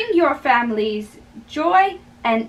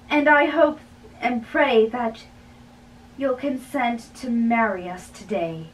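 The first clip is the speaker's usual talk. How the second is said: normal talking voice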